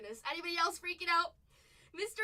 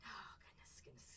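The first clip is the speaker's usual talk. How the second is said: whispered goodness; 19 dB quieter than usual. Real, not acted.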